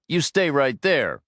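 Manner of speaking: woman ordering the driver to stay put, mild shout. A narrator's reading. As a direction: call out firmly at the man in the truck. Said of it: "she shouted".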